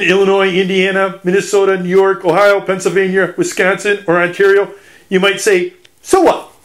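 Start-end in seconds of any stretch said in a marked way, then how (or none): 6.0-6.5 high-pitched imitated "so what" shrug; mock dismissive. Imagined listener who never visits the Great Lakes, mock dismissive. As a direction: toss it off as an imagined doubter, pitched way up. Voices an indifferent listener's retort.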